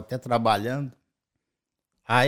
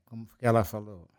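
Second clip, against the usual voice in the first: ordinary talk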